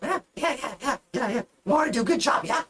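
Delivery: high, eager goblin agreeing. Acted. Goblin girl Mora fishing for praise from her captain, high and eager. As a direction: nod along like an eager little goblin.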